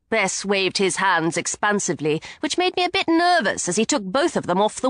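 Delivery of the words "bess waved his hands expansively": usual speaking voice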